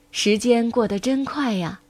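ordinary delivery

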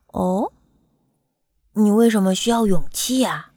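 doctor's puzzled, curious question. A narrator's reading. Doctor questions the little bumblebee, curious. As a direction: ask with gentle curiosity. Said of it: "博美尔医生好奇地看着他".

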